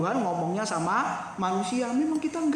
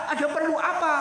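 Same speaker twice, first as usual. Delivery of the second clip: mock yell; 7 semitones higher than usual. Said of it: "langsung teriak".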